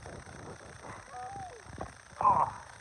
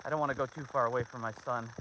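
usual speaking voice

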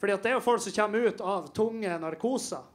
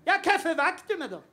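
much higher and louder, whiny protest; indignant mom; 8 semitones higher, 4 dB louder than usual. Retold or imitated. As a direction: complain like a mom woken for nothing. Mom annoyed at being woken.

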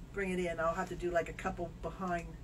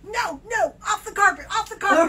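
high, loud urgent command; mock alarmed yell; retold shout at dog; 11 semitones higher, 11 dB louder than usual. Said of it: "And I go".

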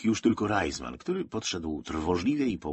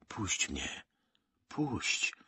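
hushed but insistent, pulling free; hissed; 3 semitones higher, 5 dB quieter, much slower than usual. A narrator's reading. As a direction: hiss it through tight breath. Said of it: "wysyczał Bednarczyk".

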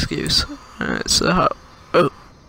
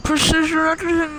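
high, lisping through a retainer; wearing a retainer; 11 semitones higher than usual. Real, not acted. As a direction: retainer lisp, pitched up. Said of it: "Can't say it when my retainer".

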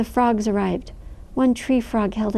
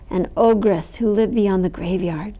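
usual speaking voice

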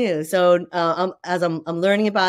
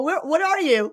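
puzzled questioner; 10 semitones higher than usual. Retold or imitated. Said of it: "I've gotten two reactions".

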